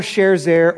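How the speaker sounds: "Sharezer" squeezed into two syllables, SHARE-zair; alternative pronunciation. Shown as a demonstration. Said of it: "pick out whatever name".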